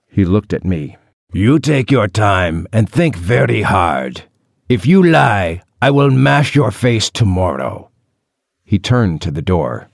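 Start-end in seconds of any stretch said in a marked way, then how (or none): 1.3-7.9 measured, menacing gang boss; threatening captor. Acted. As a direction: deliver a cold warning to a captive. Narrator voices threatening captor.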